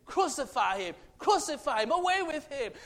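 high, loud crowd yell; crowd shouting. Retold or imitated. The crowd yelling to Pilate, a high loud shout. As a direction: shout it like a mob. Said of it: "You know what they say?".

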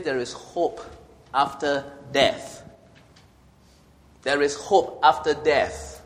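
slow, solemn proclamation; preaching. Acted. Preacher declaring hope to the congregation, slow and solemn. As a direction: draw each word out like a promise.

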